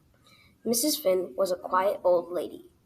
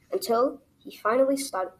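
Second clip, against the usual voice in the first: usual speaking voice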